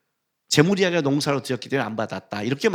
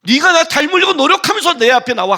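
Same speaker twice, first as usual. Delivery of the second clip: much higher, loud rebuke; shouting rebuke; 11 semitones higher, 8 dB louder than usual. Acted. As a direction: hurl it at the worshipper. God's voice rising. (하나님이 카인에게, 높고 크게 꾸짖듯 외침)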